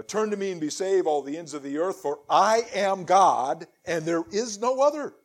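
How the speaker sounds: solemn, declaiming Bible reading; scripture reading. Acted. Preacher reading Isaiah to his congregation, solemn declaiming. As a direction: proclaim the words of the Lord to the congregation.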